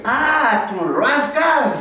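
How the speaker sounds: put-on high, drawn-out American accent, suddenly understanding. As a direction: mimic an American finally getting it, stretched out. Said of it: "un Américain, il me voit".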